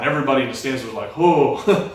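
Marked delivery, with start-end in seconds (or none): 1.2-1.9 higher, louder amazed whoa; crowd gasp. Retold or imitated. Stands reacting to his clutch throw, loud amazed whoa. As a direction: play the whole stands reacting at once.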